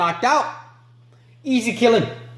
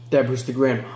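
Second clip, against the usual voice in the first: ordinary talk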